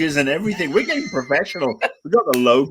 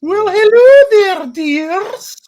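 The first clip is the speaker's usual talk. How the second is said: put-on much higher, slow, loud old-nanny falsetto; Mrs Doubtfire impression; 7 dB louder, slower than usual. Co-host greeting the others as a hammed-up Scottish nanny. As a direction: coo it like a sweet Scottish nanny. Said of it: "I'm Missus Doubtfire".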